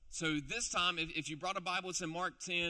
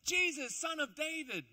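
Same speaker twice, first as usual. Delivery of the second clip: much higher and louder, pleading; desperate yelling; 10 semitones higher, 4 dB louder, slower than usual. Acted. Blind beggar yelling to Jesus across the crowd, desperate and loud. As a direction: cry out with everything you've got. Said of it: "he starts yelling".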